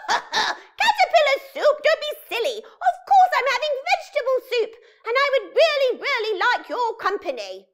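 high, loud, laughing witch. Acted. Witch on the phone laughs off caterpillar soup, high and loud. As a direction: brush off the question with a cackle.

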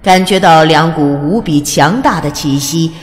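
plain reading voice

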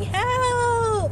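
high mock scream for help; retold scream. Retelling calling out the window, a high mock scream. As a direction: yell it like you're trapped in the car. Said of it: "my head out the window screaming".

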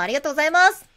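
cheery cashier gratitude; clerk thanks. Acted. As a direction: gush thanks at the customer.

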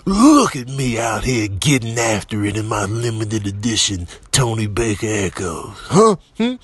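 ordinary talk, nothing marked

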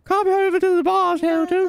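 put-on high falsetto girly voice. Shown as a demonstration. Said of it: "if you ask in a girly voice".

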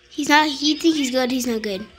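usual speaking voice

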